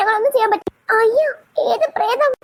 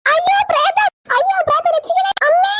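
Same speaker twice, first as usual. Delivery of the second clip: terrified scream; 8 semitones higher than usual. Acted. Girl sees the ghost.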